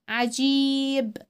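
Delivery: عجيب with a held vowel; long ii in عجيب. Shown as a demonstration. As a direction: stretch the ii of عجيب. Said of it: "بيطول صوته ازاي".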